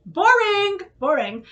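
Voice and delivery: first boring jumps high and loud; a playful little-girl pout; mock bored. As the little girl scorning plain pancakes, mock bored.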